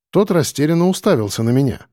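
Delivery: ordinary delivery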